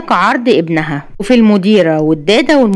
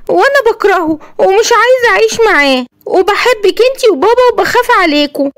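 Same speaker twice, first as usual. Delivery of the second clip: put-on higher, small child's voice, timid; a frightened boy confiding in his mum; scared little boy; 11 semitones higher than usual. Yassin tells his mother he fears for her, childlike and timid.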